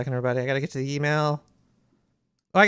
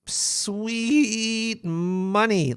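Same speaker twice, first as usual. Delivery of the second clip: greedy drawl; 3 semitones higher, much slower than usual. Acted. Craving money for ads.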